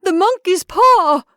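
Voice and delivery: loud, high, drawn-out frantic cry; wild shriek of sudden realization. A narrator's reading. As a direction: cry it wildly, stretching the words. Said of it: "she cried wildly".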